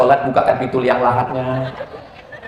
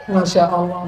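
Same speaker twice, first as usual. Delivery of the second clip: normal talking voice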